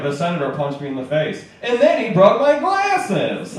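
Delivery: nasal-sounding eighties nerd complaining. Retold or imitated. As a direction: whine like an eighties movie nerd. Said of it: "an eighties nerd voice".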